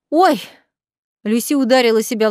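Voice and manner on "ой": sudden high, loud oops; startled oh. Acted. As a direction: catch yourself after a slip. Maid blurts a slip.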